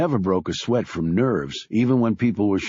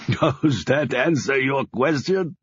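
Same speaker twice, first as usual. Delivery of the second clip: booming laugh; 5 semitones higher, slower than usual. A narrator's reading. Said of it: "Laughing, he boomed".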